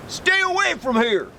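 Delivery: put-on old grocer yelling a warning from his shop. A narrator's reading. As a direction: yell at them to keep away. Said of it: "he yelled".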